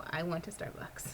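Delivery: sheepish whispered admission; whispered punchline. Real, not acted. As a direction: whisper it like a guilty confession. Comic punchline, unvoiced.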